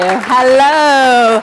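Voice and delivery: long, loud, sung-out hello; drawn-out hello. Real, not acted. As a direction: stretch the greeting out to the whole room. Greeting the crowd from stage.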